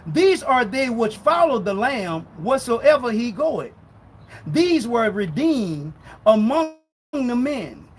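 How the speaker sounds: slow, emphatic scripture reading. Real, not acted. Preacher reading Revelation to doubters, slow and emphatic. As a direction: read it slow, driving each phrase home. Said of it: "I'll read it slow".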